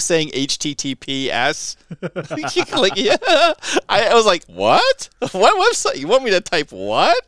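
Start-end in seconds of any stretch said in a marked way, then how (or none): 4.5-7.2 baffled. Retold or imitated. Caller recalls being lost on the phone, baffled and high. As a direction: ask in confused disbelief.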